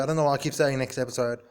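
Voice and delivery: ordinary delivery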